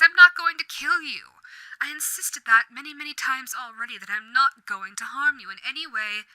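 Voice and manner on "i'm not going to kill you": louder and higher, leaning on "not"; exasperated demon calms a scared newcomer; exasperated insistence. Acted. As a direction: insist it, fed up with being doubted. Exasperated reassurance to frightened listener.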